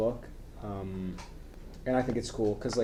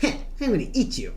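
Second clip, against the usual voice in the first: anime voice; 7 semitones higher than usual. Pretend. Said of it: "it's Ash Ketchum".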